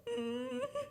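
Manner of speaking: high, soft mock sobs; tiny squeaky imitated crying; imitated whimpering. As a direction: whimper like a crying little child. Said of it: "starts whimpering".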